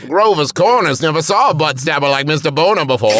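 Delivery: a light mock New England accent, called out; a playful hint of a Maine accent; hammed-up Maine accent. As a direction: boom it like a Maine old-timer. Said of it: "the way that you are doing this accent".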